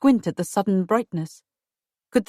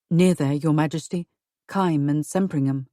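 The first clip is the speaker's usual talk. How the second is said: lower, grave husband voice; husband's voice; 4 semitones lower than usual. Acted. Husband warning his wife of treason, lower and grave. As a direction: voice a worried husband, lower and steady.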